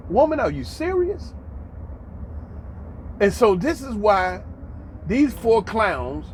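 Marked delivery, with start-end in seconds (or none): 0.1-1.3 high-pitched, scolding disbelief; incredulous. Acted. Man addressing the woman who said natives, incredulous. Reacts to sister saying natives.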